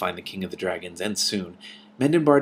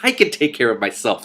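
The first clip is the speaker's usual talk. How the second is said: sharp, louder retort; snapped; 3 semitones higher, 7 dB louder than usual. A narrator's reading. Princess snapping at the king, irritated. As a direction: bite the words off. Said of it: "Simran snapped".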